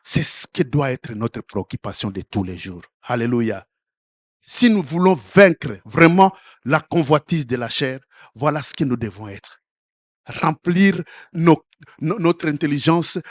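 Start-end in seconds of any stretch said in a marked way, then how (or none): none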